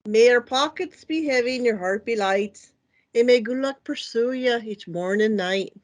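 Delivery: Irish accent for a blessing; put-on Irish brogue, measured; best Irish voice. Shown as a demonstration. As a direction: recite a blessing in an Irish lilt. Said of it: "my best Irish voice".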